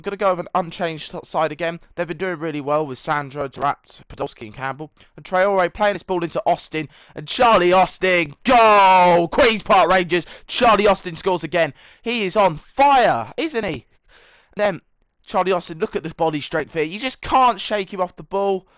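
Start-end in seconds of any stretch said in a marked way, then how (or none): 5.2-16.7 loud, high play-by-play call of the goal; hyped football commentator voice; excited match commentary. Acted. Does live match commentary.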